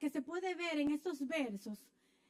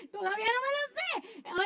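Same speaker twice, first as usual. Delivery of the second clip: laughing; 6 semitones higher than usual. Real, not acted. Preacher laughing at herself over forgotten names, laughing. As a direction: laugh through the admission.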